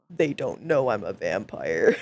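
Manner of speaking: very deep, hammed-up vampire voice; mock vampire. Bird player posing as vampire, very deep mock voice. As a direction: sink right down low for the secret.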